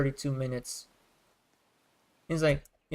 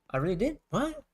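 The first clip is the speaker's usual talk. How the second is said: sudden high squeak of disbelief on What; retold shock, pitch shooting up; mock surprise; 8 semitones higher than usual. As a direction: act out your own startled double take. Retelling own shocked reaction.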